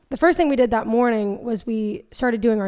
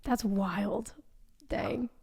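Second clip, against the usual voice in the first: whispering; 9 dB quieter, much slower than usual. Real, not acted. Awed reaction whisper.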